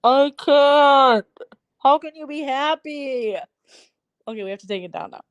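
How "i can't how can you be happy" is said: loud, high gag then cry; gagging outcry. Real, not acted. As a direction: choke out a loud disgusted protest. Gagging at gross picture.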